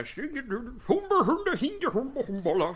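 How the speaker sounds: high, singsong Muppet gibberish; Swedish Chef. Pretend. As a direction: babble nonsense in a mock Scandinavian lilt. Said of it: "you sound like the Swedish Chef".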